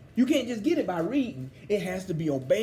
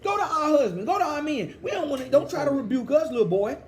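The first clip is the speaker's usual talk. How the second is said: higher, scornful women's voice, waving him off; defiant women; 7 semitones higher than usual. Retold or imitated. The women dismissing the young prophet, higher mocking voice. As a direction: sneer at the young prophet as a little boy. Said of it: "They said".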